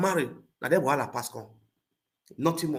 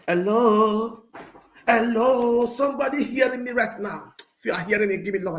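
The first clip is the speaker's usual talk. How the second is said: calling out; 8 semitones higher than usual. Real, not acted. Calling out to audience.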